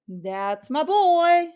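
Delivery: slow, high, loud proud shout. A narrator's reading. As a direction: cheer him on with pride. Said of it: "Tío Tito shouted".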